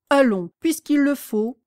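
ordinary delivery

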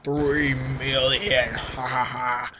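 slow, low mock-villain gloat with a fake laugh. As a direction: drag out the boast like a cartoon villain. Gloating as big channel.

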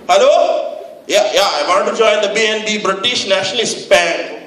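put-on prank caller with Pakistani accent. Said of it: "I pretended to be Pakistani".

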